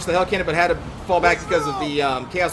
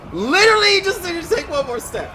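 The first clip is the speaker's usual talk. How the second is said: much higher, strained complaint; high, exasperated rant; 13 semitones higher than usual. Real, not acted. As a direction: whine it in disbelief. Exasperated at his blunder.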